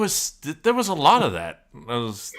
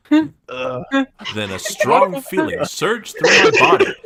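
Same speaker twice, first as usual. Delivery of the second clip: hammed-up dramatic; 11 semitones higher, 9 dB louder, much slower than usual. Dramatic reading of erotic fanfic.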